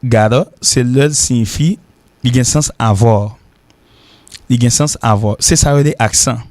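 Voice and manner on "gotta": gotta said as "GAH-duh"; gotta, open first vowel, tapped middle; first pronunciation demo. Shown as a demonstration. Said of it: "Le prononcer".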